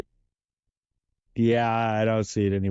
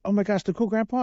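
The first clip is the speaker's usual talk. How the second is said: imitating the wife, much higher and fast, gushing; excited wife; 10 semitones higher, faster than usual. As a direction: play his starstruck wife squealing the name. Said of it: "She's like".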